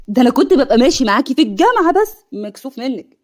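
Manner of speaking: sneering, taunting tone; mock her cruelly; mean mocking laugh. A narrator's reading. Ahmed taunting Hanin with a nasty laugh. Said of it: "احمد بضحكه لئيمه".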